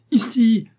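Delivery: high, loud shout; crying out. Acted. Riri shouts to the rescuers where she is, loud. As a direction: yell to guide the rescuers. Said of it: "cria Riri".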